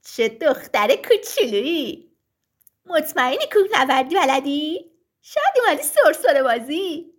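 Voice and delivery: loud singsong taunts in a put-on voice; mocking voices. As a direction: tease the little climber with exaggerated swoops. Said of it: "صداها شروع کردن به مسخره کردنش".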